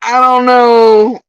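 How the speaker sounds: high, loud, stretched-out reply; exaggerated doubt. Answering the woman in the clip, loud drawn-out mock doubt. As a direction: draw the words out high and loud.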